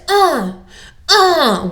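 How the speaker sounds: put-on a toddler with fists clenched, grunting; frustrated toddler grunts. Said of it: "it may sound like this".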